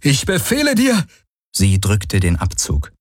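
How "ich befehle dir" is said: high, frantic order; screamed command. A narrator's reading. Valyr ordering Kirai not to fire, screaming. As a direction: cry out an order, pitch soaring. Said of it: "schrie Valyr abermals".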